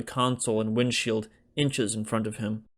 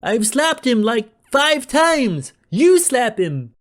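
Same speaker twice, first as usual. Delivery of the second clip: put-on frantic young guy; 12 semitones higher, 8 dB louder, slower than usual. A narrator's reading. Tommy frantic, arguing.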